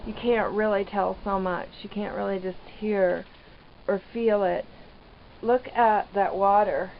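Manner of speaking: usual speaking voice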